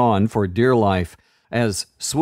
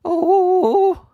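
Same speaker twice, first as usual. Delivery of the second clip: much higher, louder terrified wail; 4 dB louder than usual. A narrator's reading. Frightened girl wailing louder, terrified. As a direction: let the wail climb. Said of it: "getting louder and louder".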